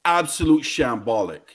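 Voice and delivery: ordinary delivery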